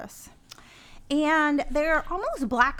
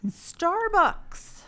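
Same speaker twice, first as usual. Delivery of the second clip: higher, lightly performed radio announcement; radio reading voice; 6 semitones higher than usual. Acted. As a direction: announce it as an ad headline. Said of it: "It's my radio voice".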